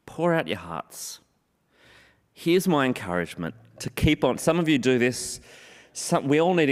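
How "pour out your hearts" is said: "Pour out your hearts" slow, low and soft, "Pour" leaned on; soft emphasis. Acted. Pastor urging the congregation to pour out their hearts, softly. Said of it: "Pour out your hearts to him".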